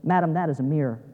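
low, quiet deadpan curator. Retold or imitated. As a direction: deliver it with no expression at all. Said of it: "with no expression at all on his face".

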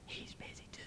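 tiny hushed whisper; whispering child. Acted. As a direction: whisper it secretly like a little kid. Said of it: "Still whispering, the voice said".

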